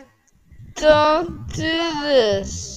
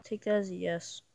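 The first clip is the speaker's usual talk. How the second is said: whisper; 4 semitones lower, 18 dB quieter than usual. Acted. Deciding to revive friend.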